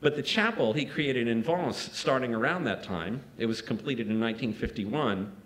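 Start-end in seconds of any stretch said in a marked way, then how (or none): none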